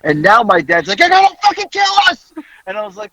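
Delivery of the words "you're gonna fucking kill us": high, loud, fast mock-dad scream; retold panicked yelling. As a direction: yell it fast like a terrified passenger. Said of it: "whole fucking cluster of yelling".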